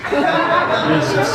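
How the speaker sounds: ordinary talk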